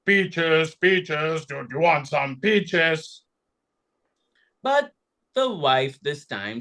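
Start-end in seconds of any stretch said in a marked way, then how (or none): 0.0-3.2 lord trying the call. A narrator's reading.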